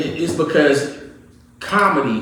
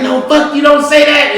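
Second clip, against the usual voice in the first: raised, high-pitched protest; mock objection; 12 semitones higher, 9 dB louder than usual. Imitating critics telling a comedian off, loud protest. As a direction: object loudly, imitating outraged listeners.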